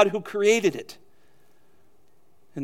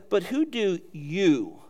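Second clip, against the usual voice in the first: slow, with weight landing on "you"; emphatic you; 3 dB quieter, slower than usual. Shown as a demonstration. Preacher quoting Jesus' question to the congregation, emphatic on "you". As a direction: draw out "you" at the end as the emphatic word. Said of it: "You is always emphatic".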